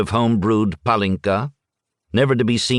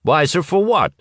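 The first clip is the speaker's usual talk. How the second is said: mild anger; 7 semitones higher than usual. Acted. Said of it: "turned around in anger".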